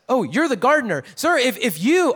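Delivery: mildly accusing the gardener of taking the body. Retold or imitated. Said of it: "almost like maybe she's even accusing him".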